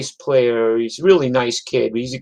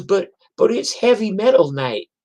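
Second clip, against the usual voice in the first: higher, stammering protest; flustered club owner; 8 semitones higher than usual. Retold or imitated. Club owner explaining the mix-up, higher and stammering. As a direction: stumble through the excuse. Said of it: "He goes".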